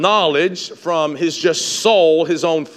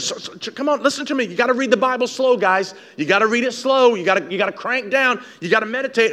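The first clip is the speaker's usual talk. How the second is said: ordinary talk, nothing marked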